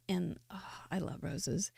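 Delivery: soft breathy whisper. Real, not acted. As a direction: whisper it like a secret. Aside confessing love of roses.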